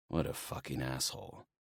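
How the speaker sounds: soft, low inner curse; muttered thought. Acted. Dionysus privately cursing the guard, hushed. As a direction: say it under your breath.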